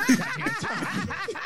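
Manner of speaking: slow, laughing echo of goddamn topics; drawn-out laughing goddamn topics; laughing repeat. Real, not acted. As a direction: laugh the words out slowly.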